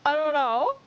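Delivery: shrieking laugh. Real, not acted. Streamer admits not knowing Fizz Buzz, squealing laugh.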